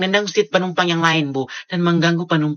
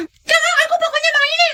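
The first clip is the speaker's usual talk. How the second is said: put-on high-pitched whining kid crying for a toy; whiny child; 7 dB louder than usual. Spoiled kid whining to mom at the store, crying. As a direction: wail like a spoiled child who wants it.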